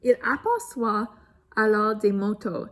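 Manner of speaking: lively storybook read-aloud; reading to children. A narrator's reading. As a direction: tell it brightly to young listeners. Reads picture book to children.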